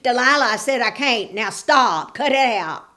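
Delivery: annoyed Samson. A narrator's reading. Samson fed up with Delilah's nagging, irritated. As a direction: snap at her to stop. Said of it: "Samson said".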